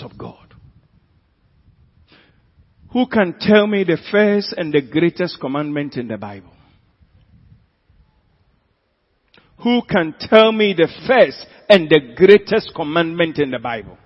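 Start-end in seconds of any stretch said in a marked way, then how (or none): 2.9-14.0 loud preacher's challenge to the congregation; full-force pulpit question; forceful preaching. Real, not acted. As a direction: put the question out loudly, then louder.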